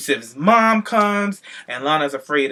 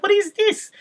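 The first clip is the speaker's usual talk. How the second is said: imitating Rusev's mom. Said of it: "she's like".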